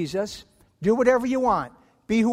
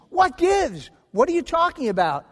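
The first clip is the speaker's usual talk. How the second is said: indignant scream; 6 semitones higher than usual. Acted. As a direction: shriek in outraged self-defence. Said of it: "And the offender screams".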